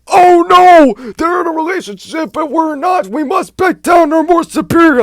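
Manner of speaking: very high, squeaky mock panic; mock scared whine. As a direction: parody a frightened coward. Mocking scared people.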